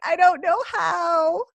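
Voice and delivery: tearful reply. Retold or imitated. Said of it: "I just, like, burst into tears".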